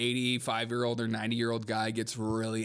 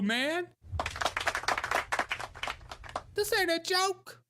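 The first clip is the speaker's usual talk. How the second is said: high, hammed-up angry old man, higher all through; furious old man; 13 semitones higher than usual. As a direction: shout at the heckler like a ninety-year-old picking a fight. Playing an angry ninety-five-year-old.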